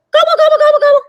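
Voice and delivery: high, loud turkey voice; turkey gobble. Acted. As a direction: gobble like an excited turkey for the kids. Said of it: "when I talk, I sound like this".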